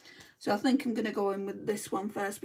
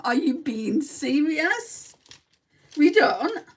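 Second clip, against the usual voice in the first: indignant protest; 6 semitones higher, 8 dB louder, slower than usual. Real, not acted. Protesting a chat comment, indignant.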